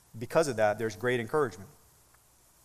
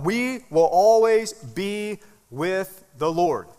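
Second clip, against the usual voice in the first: "We will always be with the Lord" stretched out word by word; very slow, pausing through "always be with the Lord"; emphasis on relationship; 4 semitones higher, 4 dB louder, much slower than usual. Shown as a demonstration. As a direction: give "always" and "with" room, at half speed. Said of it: "the emphasis of salvation is the relationship".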